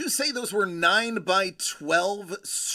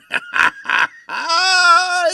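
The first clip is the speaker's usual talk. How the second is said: loud laugh; 10 semitones higher, 10 dB louder than usual. Real, not acted. Laughing at awkward chat name.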